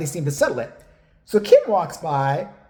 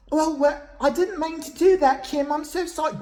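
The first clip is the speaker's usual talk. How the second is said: higher-pitched, mock-apologetic girl; imitated flustered apology; 9 semitones higher than usual. Girl who splashed Kim apologising, high flustered imitation. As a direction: play the girl pleading sorry, pitched well up.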